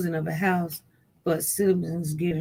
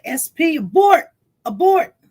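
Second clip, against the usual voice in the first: high, loud urgent alert; mock robot warning; 10 semitones higher, 9 dB louder than usual. Telling the streamer to calm down, mock alarm. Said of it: "just like they would do, like, that one robot".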